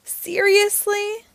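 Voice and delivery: high, drawn-out disbelieving "Seriously?"; incredulous. Acted. Jack stunned the narrator agreed, high and slow. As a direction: stretch it out in shocked disbelief.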